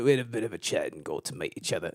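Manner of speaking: low, soft, attempted Australian accent. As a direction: try an Australian accent on the quote. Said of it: "That's Australian, right?".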